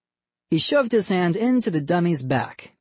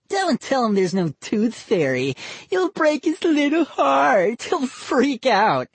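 high, slowing mock sympathy; mock pity kid voice; 10 semitones higher than usual. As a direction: fake heartbreak, drawn out. Boy character voice.

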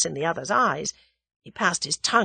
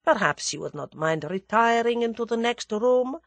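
polite Russian request, pitched up; lightly voiced formal Russian; suave Russian dignitary; 6 semitones higher than usual. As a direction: ask courteously as a Russian grandee.

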